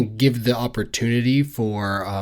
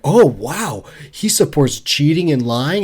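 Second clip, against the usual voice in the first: mock shocked Sarah; 5 semitones higher, 4 dB louder than usual. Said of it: "She's like".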